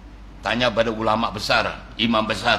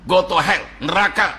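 warning of hell; 5 semitones higher than usual. Acted. Preacher warns of hell.

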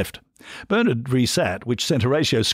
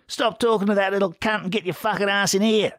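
much higher, coarse yelling barmaid; bellowing wife; 11 semitones higher than usual. A narrator's reading. The wife ordering Steve back to the bar, coarse shouting. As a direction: yell orders at him from the next room. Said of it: "she bellowed".

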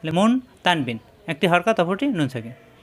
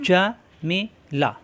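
Jamila stretched slowly, each syllable long; slow careful first reading; slow drawn-out reading; 2 semitones higher, much slower than usual. Shown as a demonstration. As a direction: draw the word out slowly. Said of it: "তাড়াতাড়ি হরকত উচ্চারণ".

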